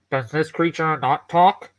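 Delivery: lightly altered metal construct voice. Acted. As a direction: ask with curious, mechanical calm. Nigel asks about bird.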